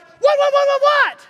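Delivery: very high, loud comic panic; panicked shout. Retold or imitated. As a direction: fire the word out repeatedly in mock alarm. Panicked reaction to wife's gasp.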